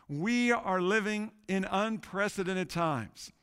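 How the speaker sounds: ordinary delivery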